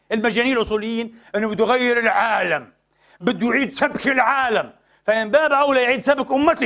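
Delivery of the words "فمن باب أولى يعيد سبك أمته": ordinary talk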